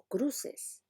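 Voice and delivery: "cruces" with the final S clear; standard plural. Shown as a demonstration. Teacher contrasting the standard plural. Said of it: "el hablante que pronuncia la S plural".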